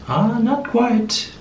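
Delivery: high, slow, doubtful mock voice; Kathy impression. Listener mimicking the English guest failing to feel rocks, high and drawn-out. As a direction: stretch it out high and politely unconvinced. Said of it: "She'll be like".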